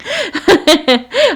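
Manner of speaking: bright, high burst of self-conscious laughter; embarrassed laugh. Real, not acted. Laughing at herself to her viewers, embarrassed laugh.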